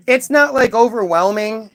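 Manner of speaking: ordinary delivery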